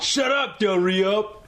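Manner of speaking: high-pitched acted outburst; mock-angry yell. Co-host as bar antagonist to Del Rio, mock angry. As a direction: cut him off, hammed-up and high. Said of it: "let's act out the scene".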